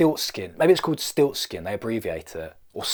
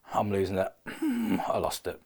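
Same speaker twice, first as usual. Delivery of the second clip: ordinary delivery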